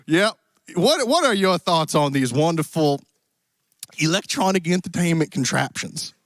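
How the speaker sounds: put-on cowboy accent. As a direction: play a genial Western cowboy host. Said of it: "apparently some cowboy accent".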